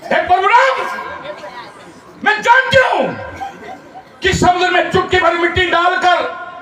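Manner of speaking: high, declaimed squirrel's plea; storyteller voicing a devoted little squirrel; humble squirrel's appeal. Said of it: "गिलहरी ने परशुराम के चरणों में नमन करते हुए कहा".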